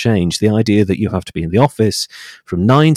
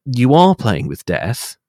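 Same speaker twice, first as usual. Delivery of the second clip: usual speaking voice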